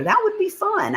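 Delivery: high, bright excitement; delighted. Real, not acted. Streamer reacts to a viewer's event idea, high delighted reply. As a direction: jump up in pitch with delight.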